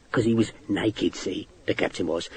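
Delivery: a sergeant hushing scandal to officers; confiding hush. A narrator's reading. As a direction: lean in and tell it low.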